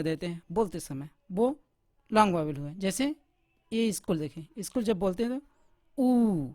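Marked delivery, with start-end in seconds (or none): 6.0-6.5 ऊ held long; long vowel. Shown as a demonstration. Teacher showing the long vowel in school. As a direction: stretch the ऊ out. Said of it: "लंबा समय दे रहे हैं ना".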